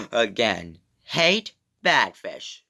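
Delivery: normal talking voice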